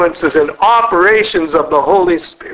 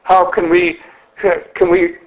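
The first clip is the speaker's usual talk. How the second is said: normal talking voice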